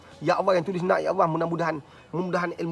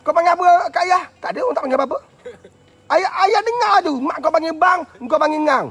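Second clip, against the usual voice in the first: high, loud indignant questioning; acted telling-off at raised volume; mock father scolding; 11 semitones higher, 7 dB louder than usual. Father confronting his child over a nickname, mock scolding.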